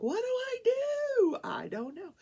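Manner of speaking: high-pitched mock panic; panicked. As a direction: replay your own flustered alarm, voice shooting up. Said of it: "And I'm like".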